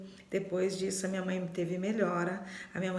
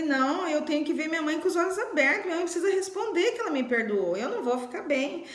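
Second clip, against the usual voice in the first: desperate pleading; 6 semitones higher than usual. Retold or imitated. Daughter insisting her dying mother must forgive her, frantic high plea. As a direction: plead frantically, fighting panic. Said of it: "E eu fiquei desesperada".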